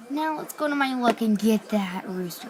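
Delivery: ordinary talk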